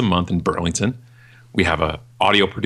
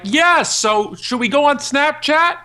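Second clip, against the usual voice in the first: much higher, slow, eager voice; imitating eager client; 4 dB louder, slower than usual. Clients asking about Snapchat, mock eager high voice. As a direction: play the keen client jumping on a trend. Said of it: "They're like".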